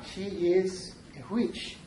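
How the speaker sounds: yeah, she is a witch, stretched very slowly; slow witch drawl. Pretend. Teacher to students, slowly savouring the witch. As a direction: draw out each word, savouring it.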